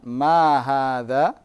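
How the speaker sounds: Ma hadza slow, MA stressed, rising at the end; question intonation. Shown as a demonstration. Teacher shows students the rising tune of Ma hadza. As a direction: draw out Ma hadza and let it climb like a question. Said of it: "lihat intonasinya, dengarkan".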